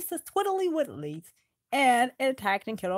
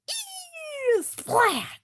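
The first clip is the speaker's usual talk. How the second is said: splat sound; 12 semitones higher than usual. Acted.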